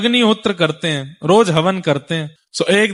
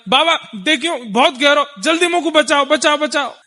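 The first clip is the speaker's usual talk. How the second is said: mock cry for help; 7 semitones higher than usual. Thakur ji fakes drowning.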